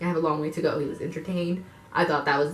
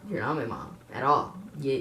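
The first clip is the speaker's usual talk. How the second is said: normal talking voice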